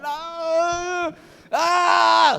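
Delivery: high loud scream of struggle. Retold or imitated. Homeowner wrestles the devil at the door, high loud scream. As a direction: scream as you fight the devil. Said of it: "Sumisigaw na siya".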